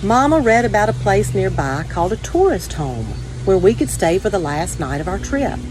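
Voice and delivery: plain reading voice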